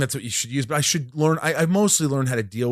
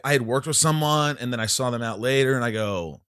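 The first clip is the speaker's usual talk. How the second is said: normal talking voice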